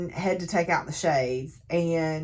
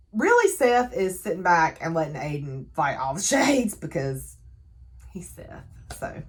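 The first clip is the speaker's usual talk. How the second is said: ordinary delivery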